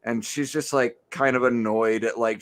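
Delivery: usual speaking voice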